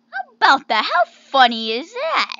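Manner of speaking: loud, swooping outburst; loud exclamation. Real, not acted. Player amazed at finding the dog, exclaiming loudly. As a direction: blurt it out big, pitch swinging up.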